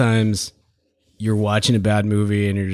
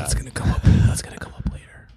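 hushed teasing whisper; 9 semitones lower than usual. Real, not acted. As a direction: whisper it like a secret. Hinting a secret, whispered.

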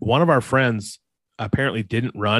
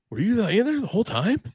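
much higher, incredulous question; retold amazement; 11 semitones higher, faster than usual. Hider to friend popping up from grass, high incredulous voice. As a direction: pitch it way up in mock disbelief.

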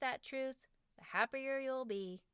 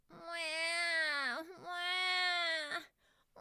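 high mock wailing cries, hammed-up; imitated wailing; 7 semitones higher, much slower than usual. Mom imitating her wailing son: high mock crying. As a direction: wail like a sulking boy under a blanket, mock cry. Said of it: "Dying cat sounds faded away".

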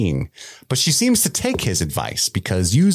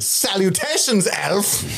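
much higher, formal salutation; pompous greeting; 9 semitones higher, slower than usual. Retold or imitated. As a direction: greet grandly. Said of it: "He's like".

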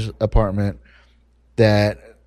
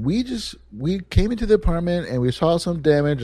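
nice as could be; 7 semitones higher than usual. Retold or imitated. Wife raising damage at leasing office, sweetly polite. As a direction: gently tiptoe into the complaint, pitched up. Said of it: "nice as could be".

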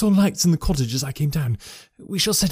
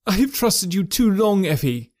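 higher, firm rebuke; stern cry; 7 semitones higher than usual. A narrator's reading. Husband refusing his wife's plea, stern and raised. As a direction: cut her off sternly. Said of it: "he cried sternly".

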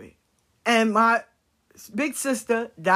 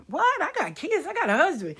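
indignant protest; 7 semitones higher than usual. Retold or imitated. Protesting grandmother in kitchen.

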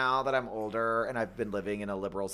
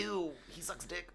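put-on disgusted teen; 5 semitones higher, 11 dB quieter than usual. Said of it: "They're like".

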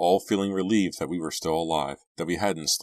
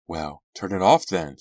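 louder, mildly cross order; angry; 6 dB louder than usual. A narrator's reading. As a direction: push it out with some anger. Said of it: "Shannon said angrily".

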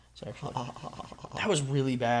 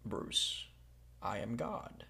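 very soft impression of a film actor playing God; hushed, understated celebrity impression; Morgan Freeman; 13 dB quieter, much slower than usual. Player giving clues in a guessing game, soft actor impression. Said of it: "Morgan Freeman".

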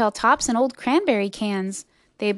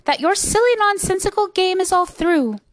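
higher, mildly indignant pronouncement; a touch of a scolding snap; snappish kangaroo; 8 semitones higher, 4 dB louder than usual. A narrator's reading. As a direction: lay down the law to a nuisance. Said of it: "snapped the big kangaroo".

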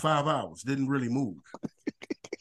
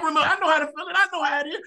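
ordinary delivery

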